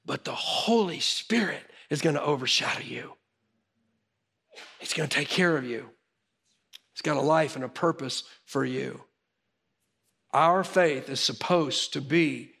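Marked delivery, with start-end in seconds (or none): 0.1-9.0 soft, low, tender reassurance; reassuring angel. Acted. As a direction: comfort a frightened listener as the angel. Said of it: "the angel saying to you".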